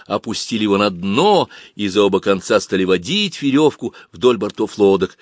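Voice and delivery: ordinary delivery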